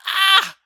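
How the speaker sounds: short, loud, high-pitched yelp; mock pain yell. Brother slugged by grandmother in church, imitated loud yelp. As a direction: imitate a kid suddenly slugged in the arm.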